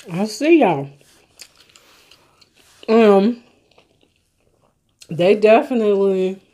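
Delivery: eating between words; talking while chewing. Real, not acted. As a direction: mouth partly full of food. Seen 2: Holds nugget, cheeks show chewing between bites while talking; not sandwich.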